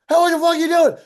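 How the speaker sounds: high, loud yell of alarmed outrage; angry shout. Retold or imitated. Manager to guitarist who holed his wall, angry shout. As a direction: imitate a manager blowing up.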